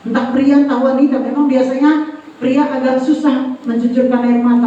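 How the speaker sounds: normal talking voice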